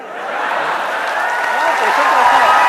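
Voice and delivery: slow, breathy laughing words. Real, not acted. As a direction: laugh through the advice. Laughs while advising patience.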